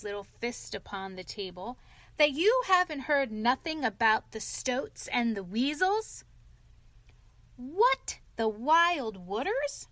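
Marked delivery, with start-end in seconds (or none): 2.2-6.2 loud, higher astonished question; incredulous shout. A narrator's reading. Rat telling Toad of Stoats and Weasels, shouting incredulously. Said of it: "shouted the Rat". 7.6-9.9 high, loud, rising disbelief; shocked cry. A narrator's reading. Toad hearing of the Wild Wooders, aghast. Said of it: "cried Toad".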